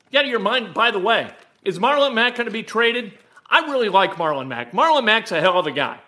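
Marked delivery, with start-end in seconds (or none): none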